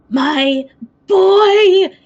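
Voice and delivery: put-on booming, cooing giant fawning over his boy; a huge voice doting on a pet. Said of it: "very loud, almost booming voice".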